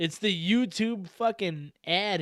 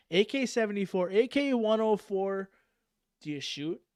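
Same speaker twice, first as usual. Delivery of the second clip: ordinary talk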